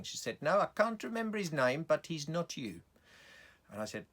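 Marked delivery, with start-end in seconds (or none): 0.4-2.8 higher-pitched South African woman; insistent lady with a South African accent. Acted. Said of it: "a woman with a South African accent".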